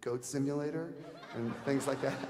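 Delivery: normal talking voice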